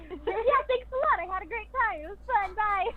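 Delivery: tossing it off, carefree and bubbly; silly Helen. Retold or imitated. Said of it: "She's just like".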